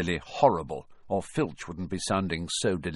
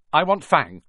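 quickly; 6 semitones higher, 7 dB louder than usual. Acted. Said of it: "said Malfoy quickly".

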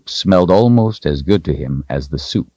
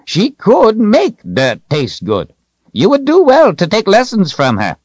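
put-on old codger getting the last word; bantering old man; 5 dB louder than usual. A narrator's reading. Old wizard Zed's character voice.

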